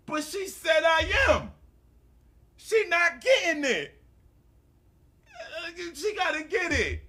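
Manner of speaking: high-pitched, shouted whine; hammed-up tantrum, almost crying; wailing protest. Retold wailing protest to wife.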